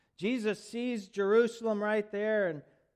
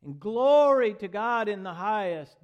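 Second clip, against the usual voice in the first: loud praise; 3 semitones higher, 7 dB louder than usual. Acted. Crowd praising Jesus as he arrives, loud praise. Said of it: "Boy, they sang and praised".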